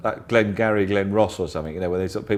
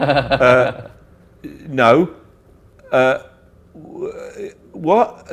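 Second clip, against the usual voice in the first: flustered caller; 6 semitones higher, 7 dB louder, much slower than usual. Retold or imitated. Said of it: "He just went".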